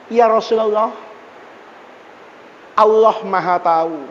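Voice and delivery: low, measured plea at a graveside; composed and sincere supplication in a low voice; calm, earnest prayer. Acted. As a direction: pray calmly, pitched well down. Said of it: "Dia tenang aja".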